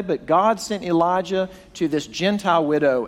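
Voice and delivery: usual speaking voice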